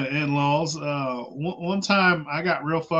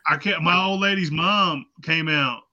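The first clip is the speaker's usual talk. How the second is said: ordinary talk, nothing marked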